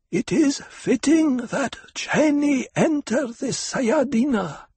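high, slow, harsh crone whisper; old woman whispering. A narrator's reading. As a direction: proclaim like an ancient priestess. Old priestess voice continues.

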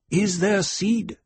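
put-on high, slow girlish question; a girl priestess asking solemnly; young girl voice. A narrator's reading.

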